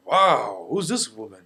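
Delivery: put-on loud, swooping accented wow; dad's accented admiration. Dad spots the woman, loud accented admiration. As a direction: play dad loudly wowed, in his accent. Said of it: "It's your accent".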